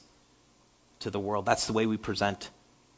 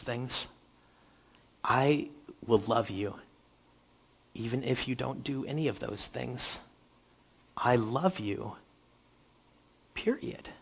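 tender fatherly love; 7 dB quieter than usual. Retold or imitated. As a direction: speak it like a father's hush. God speaking tenderly to children.

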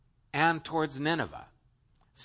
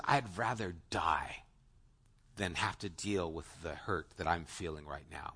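whispered, much lower and softer, defeated; despairing whisper; 10 semitones lower, 10 dB quieter than usual. Retold or imitated. Preacher voices Jonah telling God he'd rather die, whispered. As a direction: breathe it out like a man at his wit's end.

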